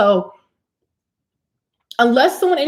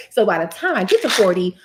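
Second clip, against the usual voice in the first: ordinary talk, nothing marked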